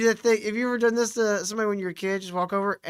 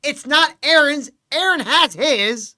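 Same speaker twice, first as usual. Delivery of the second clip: slow emphatic correction; 6 semitones higher, 7 dB louder, slower than usual. Retold or imitated. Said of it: "Nick Rekieta going".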